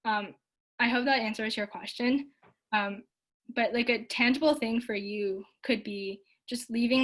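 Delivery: normal talking voice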